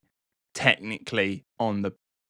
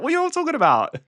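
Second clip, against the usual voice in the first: much higher, hammed-up innocent squeak; feigned innocence; 4 dB louder, much faster than usual. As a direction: play dumb in a high mock-innocent voice. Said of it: "And they're like".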